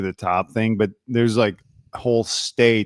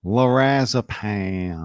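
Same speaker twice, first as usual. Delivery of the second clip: slow Southern drawl on Lorazepam; imitated Southern character; 2 semitones lower, much slower than usual. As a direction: stretch the last vowel of Lorazepam. Quoting the character's drawled medication name.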